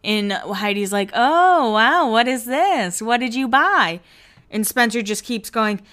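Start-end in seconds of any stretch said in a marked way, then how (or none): 1.1-4.0 loud, high, swooping wonder; exaggerated wide-eyed surprise; mock amazement. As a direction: imitate a girlfriend gushing over a purchase. Imitates girlfriend reacting to purchase.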